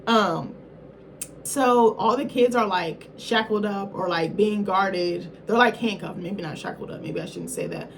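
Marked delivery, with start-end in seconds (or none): none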